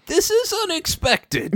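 Spike voice. Acted. Co-host voices Spike on table.

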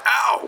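high pretend yelp of pain; quick high cartoonish "ow"; mock wincing "ow". Wounded fighter patted on the back, playful pained yelp. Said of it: "wincing 'cause we're at".

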